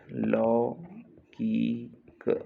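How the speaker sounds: "लौकिक" very slow, in three pieces; split slowly for dictation. Shown as a demonstration. Teacher dictating a spelling, लौकिक sound by sound.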